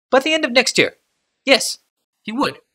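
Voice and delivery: careful servant choosing his words, pitched high; Hajar's voice. A narrator's reading. Hajar tells the truth.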